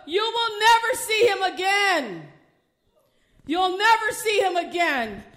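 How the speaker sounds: loud, high, forceful pronouncement; retold divine warning at full preaching force; prophetic Spirit of God. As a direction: proclaim it like a verdict from heaven. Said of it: "the Lord's Spirit said".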